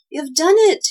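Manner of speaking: high, loud, thrilled exclamation; admiring cry. A narrator's reading. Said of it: "he cried admiringly".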